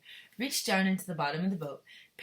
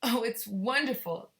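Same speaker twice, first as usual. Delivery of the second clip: delighted Charlie; 5 semitones higher, 5 dB louder, slower than usual. Acted. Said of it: "Charlie said".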